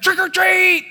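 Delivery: put-on high, loud excited kid shout; squealing child at the door; toddler yelling trick or treat. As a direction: shout it like a thrilled little boy. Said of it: "he's like".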